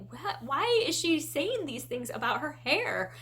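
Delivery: a parent thrown by what she reads; half-acted dismay at the hair talk; baffled disbelief. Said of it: "I was like".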